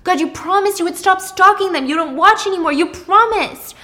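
high, loud scolding; upset. Acted. Thomas catching Pippa stalking the neighbors, upset and loud. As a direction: scold a partner who broke a promise. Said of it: "and he's upset. He's like".

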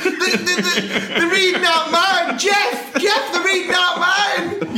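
high, laughing excited shout; excited squeal. Retold or imitated. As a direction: squeal with delight. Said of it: "The person who's got".

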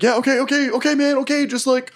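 very high, frantic pleading; panicked surrender. Acted. Cornered foe begging the attacker to stop, high panicked plea. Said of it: "He's like".

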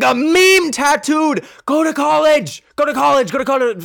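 loud, high, exasperated shouting; yelled scolding. Real, not acted. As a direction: yell it at them like urgent, fed-up advice. Scolding people getting meme tattoos.

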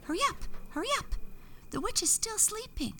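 very high, fast twittering bird voice; tweeting bird. A narrator's reading. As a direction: rush it like an excited little bird. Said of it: "came back tweeting".

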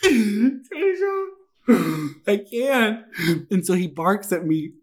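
high, breathless laughing plea; can barely get the words out from laughing; laughing helplessly. Real, not acted. Cracking up to her friend Tasia, high and laughing.

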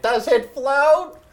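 put-on high, slow, wide-eyed child; little kid voice. Mocking Georgie at the sewer.

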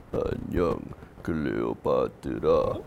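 very low, slow and soft. Acted. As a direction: dig down to a rumbling low. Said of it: "we're gonna go as low as we can".

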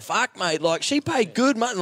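high, excited mock Aussie cheer; excited Aussie punter. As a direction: do a buzzing Aussie mate.

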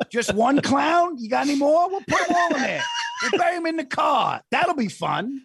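hammed-up giddy undertaker; imitating gleeful director. Director thrilled to bury clowns, high gleeful near-shout.

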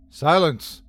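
a sharp, loud order; the leader silencing his men; commanding. A narrator's reading. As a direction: cut them off with one word. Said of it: "commanded the leader".

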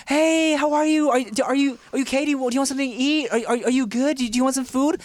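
put-on high, sugary mom checking on her kid; sweet overeager mom. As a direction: gush over her child, suddenly all nice. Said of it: "my mom would be like".

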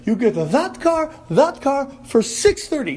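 half-hearted imitation of a yelling car salesman; mock angry salesman. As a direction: lean on it like a pushy dealer. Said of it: "when he started yelling at me".

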